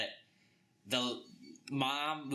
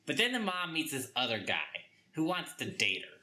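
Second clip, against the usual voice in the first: ordinary talk, nothing marked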